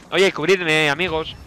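ordinary delivery

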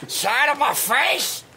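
high, slow questioning whine; put-on slow high voice; mocking impression. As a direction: try out the pained complaint, drawn out. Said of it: "I wanna hear you guys' impressions".

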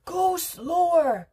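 slow, loud, high-pitched plea; panting. A narrator's reading. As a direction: wail it out, out of breath. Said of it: "panted Missus Salt".

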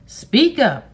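loud, high-pitched order; roared. A narrator's reading. Man roaring at a silent boy to answer. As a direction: bellow it as a sharp command. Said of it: "roared Beecham".

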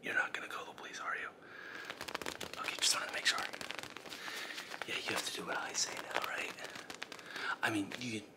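soft breathy ASMR whisper, nervous underneath; hushed ASMR voice with jittery nerves. Acted. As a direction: whisper like a creepy ASMR host. Whispering intruder.

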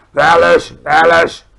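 very loud, booming insistence; roared contradiction. A narrator's reading. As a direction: bellow the words twice to crush the denial. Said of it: "brulde de bu-, de bloedbottelaar".

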